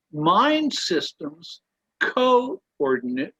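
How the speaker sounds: reading aloud, leaning on key words; emphatic reading. Acted. [name] reading the passage aloud to the group, stressing key words. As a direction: stress each system word as the quote is read out. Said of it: "you put the emphasis on the right thing".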